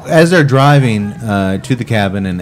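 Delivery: talking between sips; speaking into drinking glass; glass at lips. Real, not acted. Seen 0: Left man's glass is at his lips through most of the words.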